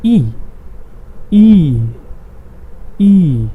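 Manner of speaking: E said as long "ee"; E as the German name of I, falling "ee"; German letter I. Shown as a demonstration. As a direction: say E as a drawn-out German "ee". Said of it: "in German".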